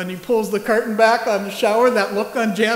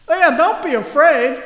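breezy mock reassurance; 7 semitones higher, 6 dB louder than usual. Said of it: "angel mock reassurance".

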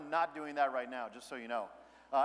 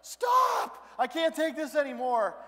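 high, loud panicked yell; inner shout; 10 semitones higher, 9 dB louder, slower than usual. Retold or imitated. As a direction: scream it like you've hit your limit. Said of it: "a part of me that's like".

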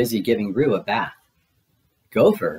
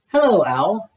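high-pitched, friendly call; storybook Pooh calling hello; 10 semitones higher, 4 dB louder than usual. A narrator's reading. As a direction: hail a passing friend in a raised pitch. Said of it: "called Pooh".

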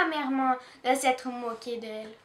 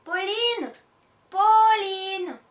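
calling out higher and louder; worried calling; 6 semitones higher, 7 dB louder, much slower than usual. Acted. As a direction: call into the night for the lost girl. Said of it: "Des voix inquiètes fusent".

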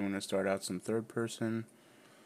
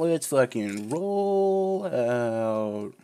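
slow, high, loud rallying call; hype call; 7 semitones higher, 7 dB louder, much slower than usual. Acted. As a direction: drag it out like a battle cry. Hyping up the game start.

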